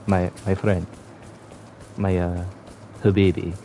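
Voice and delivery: mock-stern Indian accent telling off a rude friend. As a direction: play it as an indignant Indian man in an Indian accent. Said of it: "the Indian one".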